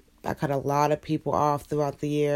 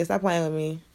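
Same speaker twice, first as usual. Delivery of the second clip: ordinary talk, nothing marked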